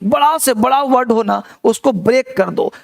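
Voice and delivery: ordinary talk, nothing marked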